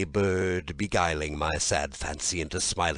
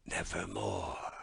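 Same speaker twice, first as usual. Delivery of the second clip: much softer, dark single word; hushed raven reply; 9 dB quieter than usual. A narrator's reading. Raven answers the narrator in a hush. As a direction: let the bird's word drop soft. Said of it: "Then the bird said".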